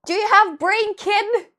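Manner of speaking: loud, high-pitched mock outrage; shouted scolding, acted out; retold angry shout. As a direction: yell it incredulously at a reckless kid. Yells her retort at the rider.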